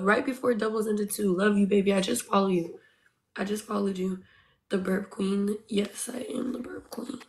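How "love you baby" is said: ordinary talk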